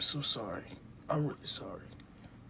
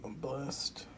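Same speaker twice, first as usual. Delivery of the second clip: ordinary talk, nothing marked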